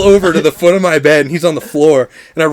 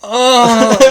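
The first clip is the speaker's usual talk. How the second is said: higher, drawn-out mock moan; imitated sleep moan; 7 semitones higher than usual. Imagining being heard moaning in a silent cinema, mock moan. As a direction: moan out as if asleep. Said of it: "did I moan at any silent parts".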